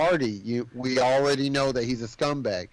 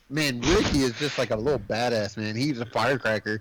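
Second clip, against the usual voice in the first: ordinary talk, nothing marked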